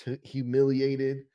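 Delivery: ordinary delivery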